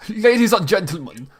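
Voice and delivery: put-on high, pompous German-accented baron; German-accented public proclamation. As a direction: announce to a crowd in a German accent. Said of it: "not the same German accent".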